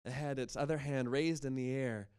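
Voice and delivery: ordinary delivery, nothing marked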